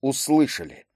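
subdued, falling exclamation. A narrator's reading. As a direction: confirm it without much joy. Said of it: "далеко не так радостно произнёс".

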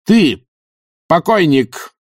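high accusing cry; shouted. A narrator's reading. Witch confronts the former corpse eating a sandwich, shouting. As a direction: call out the accusation, pitched high. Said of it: "крикнула она".

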